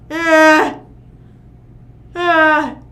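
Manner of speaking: loud, high groan of disgust; loud disgusted ugh. Real, not acted.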